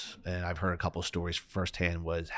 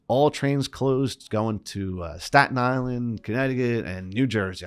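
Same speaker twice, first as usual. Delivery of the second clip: slow, loud, drawn-out announcer imitation, pitched higher; imitating the Penn Station announcement, slow and loud; mock station announcement; 4 semitones higher, 7 dB louder, slower than usual. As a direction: call it out like the station loudspeaker. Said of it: "specifically them saying".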